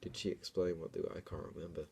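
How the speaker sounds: usual speaking voice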